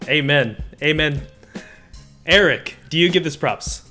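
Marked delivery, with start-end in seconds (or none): none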